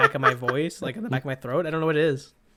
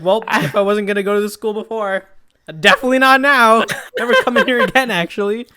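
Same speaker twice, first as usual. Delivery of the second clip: embarrassed outburst; 6 semitones higher, 11 dB louder than usual. Retold or imitated. Embarrassed retort, retold.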